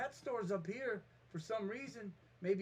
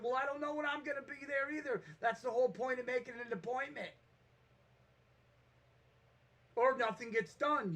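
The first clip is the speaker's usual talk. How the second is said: raised, higher exasperated retort; exasperated mock yell, higher; retold yelling; 5 semitones higher, 4 dB louder than usual. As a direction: snap back at a friend who won't commit. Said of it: "here I am yelling at him".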